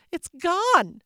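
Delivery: a high, loud anguished wail. Acted. Mama wailing to her daughters that it is gone, high loud wail. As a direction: wail it out in despair over the loss. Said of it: "she wailed".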